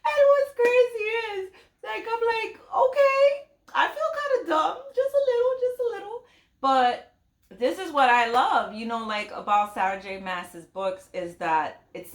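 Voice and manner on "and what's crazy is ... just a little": very high, giggly squeaky voice; giggly squeal. Real, not acted. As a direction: squeak it out, tickled by your own slowness. Giggly admission after a plot reveal.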